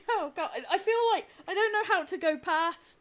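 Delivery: laughing through the words, high and bright; a real flustered giggle while talking. Real, not acted. Thanking donors, flustered.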